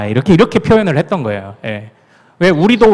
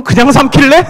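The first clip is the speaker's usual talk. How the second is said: higher, louder sarcastic comeback; mock sarcastic retort; 8 semitones higher, 6 dB louder than usual. Pastor imagining his puzzled outsider reply, mock sarcasm. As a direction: snap back in playful mock annoyance. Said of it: "내가 이렇게 얘기하지".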